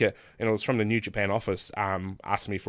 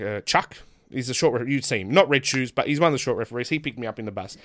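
usual speaking voice

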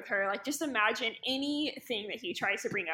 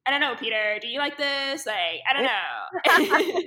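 mock exasperation; 7 dB louder than usual. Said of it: "totally gaslit him".

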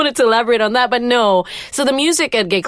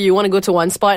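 normal talking voice

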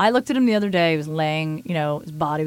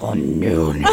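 much lower put-on voice, laughing through it; a goofy voice for the cat; silly cat voice; much slower than usual. Host greeting her cat in a goofy low put-on voice. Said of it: "all these different voices".

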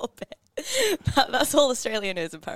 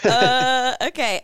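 mock taken aback, louder; bemused okay; 2 semitones higher, 9 dB louder, much slower than usual. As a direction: sound awkwardly unsure. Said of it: "I was like".

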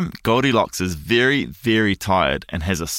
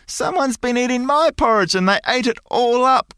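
put-on much higher, whimpering baby bear voice; crying Baby Bear; 3 dB louder than usual. A narrator's reading. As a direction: wail it like a tiny upset cub. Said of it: "cries the Baby Bear".

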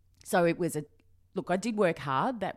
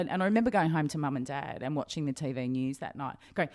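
ordinary talk, nothing marked

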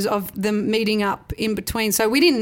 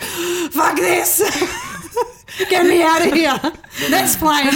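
mock yell; 7 semitones higher, slower than usual. Retold player storming off.

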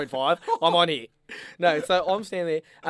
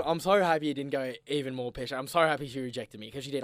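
normal talking voice

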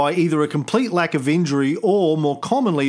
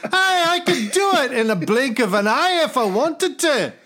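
put-on higher-pitched, belligerent Scottish accent; Scottish God; 7 semitones higher than usual. God threatening to show lassie miracles, belligerent Scottish accent. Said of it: "He must be Scottish".

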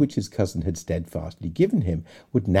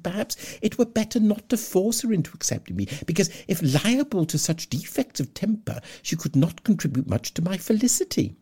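higher, self-satisfied parson voice; pompous Mr Collins; 8 semitones higher than usual. Acted. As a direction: play the smug suitor, somewhat raised. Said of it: "cried Mister Collins".

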